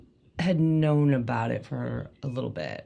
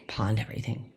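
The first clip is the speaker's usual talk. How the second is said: whisper; 5 semitones lower, 8 dB quieter than usual. Retold or imitated. Furniture all gone.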